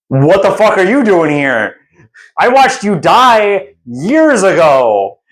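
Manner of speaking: high-pitched, drawn-out mock outrage; hammed-up shocked outburst, pitch climbing; incredulous Dandelion. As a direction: exclaim it in stunned disbelief, stretching the words. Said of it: "and Dandelion goes".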